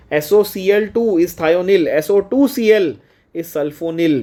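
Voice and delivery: "thionyl" और "sulfonil" को धीरे और अलग-अलग ज़ोर देकर बोलो; slow, spelled out, contrasting thionyl and sulfonil; slow contrasting stress. Shown as a demonstration. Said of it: "इसको सल्फोनिल से कंफ्यूज मत करके आना". ("S O C L two" और "S O two C L" को धीमे, हर अक्षर अलग करके)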